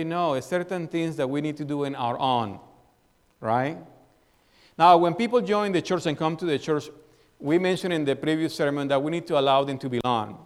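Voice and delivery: normal talking voice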